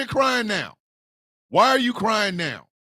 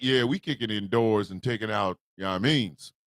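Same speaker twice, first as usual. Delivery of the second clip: ICE agent voice; 12 semitones lower, 6 dB quieter than usual. Acted. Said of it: "ice is like".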